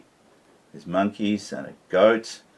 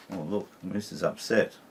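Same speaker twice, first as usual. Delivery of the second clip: plain reading voice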